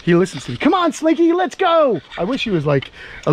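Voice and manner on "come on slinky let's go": higher sing-song coaxing of a pet; coaxing pet talk. Real, not acted. Owner coaxing his lizard toward the new house, higher sing-song. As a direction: coax the lizard along like a puppy.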